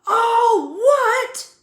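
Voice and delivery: roaring high and loud; thundering. A narrator's reading. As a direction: thunder the question at them. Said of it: "Hagrid thundered".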